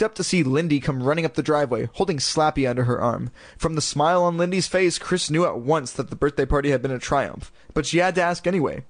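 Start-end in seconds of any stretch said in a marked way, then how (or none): none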